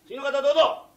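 high, very loud summons; angry call. Acted. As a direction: boss shouting in the next one. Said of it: "おい、社長、だんだん怒っちゃったよ". (怒った社長が大声で呼び込む)

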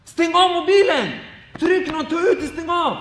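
loud, high-pitched exasperated order; exasperated shout. Acted. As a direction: call out sharply to someone whose phone is ringing. Exasperated plea to worshipper with ringing phone.

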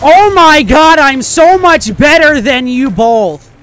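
yelling in triumph, slow and loud; gloating victory yell; excited shout. Real, not acted. Victory gloat after winning.

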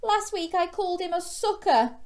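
high, loud guilty confession; young shark confessing to Mum, high; guilty child. A narrator's reading. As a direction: blurt it out ashamed. Gilbert continues his guilty outburst.

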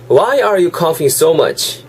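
slow, clear model reading; slow model sentence. Shown as a demonstration. Teacher reading the day's sentence slowly for students. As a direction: lay each word out slowly for learners. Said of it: "잘 들어보시면요".

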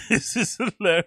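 high, loud words through laughter; laughing hard. Real, not acted. Streamer watching a bizarre guest, laughing hard. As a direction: squeeze the words out while cracking up. Cracking up at the clip.

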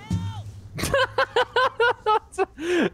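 long, high-pitched helpless laugh; high cackling laugh. Real, not acted. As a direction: laugh uncontrollably at a friend's mishap. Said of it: "I'm not laughing at you".

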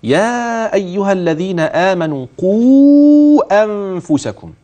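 slow, with the long vowels of يا and قوا held; elongation shown. Shown as a demonstration. Sheikh pointing out the madd for the caller, stretched. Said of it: "المد اللي عندي في الآيات فين".

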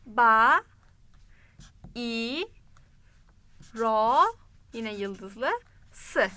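Ba, i, ro, sı split with long pauses; syllable by syllable. Shown as a demonstration. Teacher spells out the word while writing it. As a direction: spell Bayrosu out in parts. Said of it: "Duyduğumuzu bir yazalım".

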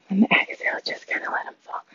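fading whisper on the exhale. Real, not acted. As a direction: let the cue drift away on the breath. Cool-down exhale cue.

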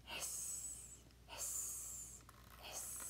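whispered hiss, hiss, hiss; hissing lizard. Acted. Teacher voicing gila monster, whispered hiss. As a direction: breathe out a snake-like hiss.

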